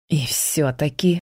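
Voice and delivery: медленно, зловеще, как злодей; произнеси угрожающе, растягивая. Acted. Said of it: "зловеще произнёс Кертис". (зловещий злодей)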